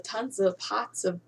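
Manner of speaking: normal talking voice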